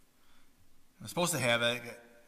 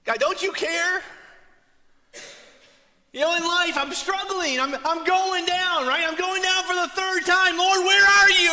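much higher and louder, a pleading cry; desperate prayer; 13 semitones higher, 6 dB louder than usual. Acted. Struggling believer crying out to a distant God, pleading loud. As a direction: cry out to God like someone going under.

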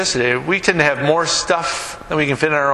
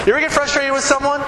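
ordinary talk

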